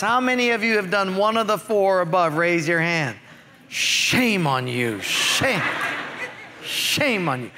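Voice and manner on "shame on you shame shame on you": teasing shame on you, lower and slow; mock scolding. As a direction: pretend to scold the raisers of hands. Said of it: "playful scolding of the congregation".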